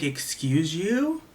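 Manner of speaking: retold affronted comeback, stretched out; indignant. Spotlight operator answering the screaming director, indignant.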